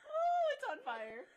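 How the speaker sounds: real high-pitched wail, half laughing; tearful, laughing squeal of pain; crying, eyes burning. Real, not acted. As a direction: wail drawn out as if your eyes sting. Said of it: "Oh, she's crying".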